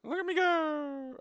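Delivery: high, slow, delighted cry; squealing with delight; gleeful. Real, not acted. Character suddenly zooms fast in game.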